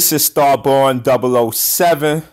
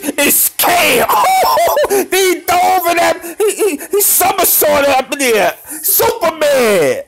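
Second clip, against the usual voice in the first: high excited yelling with a laugh; high laughing hype; hyped shriek. Real, not acted. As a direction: squeal at the somersault. Excited highlight reaction.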